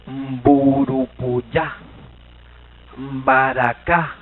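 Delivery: very slow, drawn-out syllables; demonstrating example words. Shown as a demonstration. Teacher showing Guaraní words with B, drawn out slowly. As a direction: stretch "Mburupuyá" and "Baracá" out slowly. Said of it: "cuando viene acompañada de la M".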